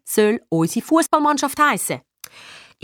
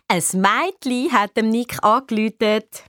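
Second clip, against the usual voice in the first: sing-song teasing. Acted. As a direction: tease a brother in sing-song. Sister teases Nick about girl's call. (neckender Singsang)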